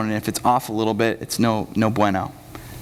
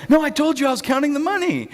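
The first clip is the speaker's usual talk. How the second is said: high, whiny-pitched comic protest; mock arguing. Judas answering the accusing disciples, mock arguing. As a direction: play a defensive Judas, voice way up. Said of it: "they're just all arguing".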